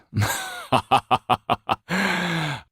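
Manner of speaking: a high, drawn-out amused laugh; loud laugh. Acted. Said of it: "the Count laughed out loud".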